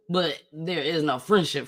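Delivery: normal talking voice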